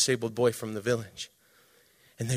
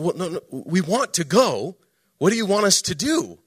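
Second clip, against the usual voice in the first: higher, louder retold plea; insistent pleading; 5 semitones higher, 6 dB louder than usual. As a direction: press for an answer, pitch climbing. Retold woman pressing Jesus for instructions.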